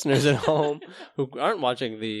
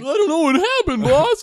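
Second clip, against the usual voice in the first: goofy high voice; 4 dB louder than usual. Pretend. Playful skit with a boss.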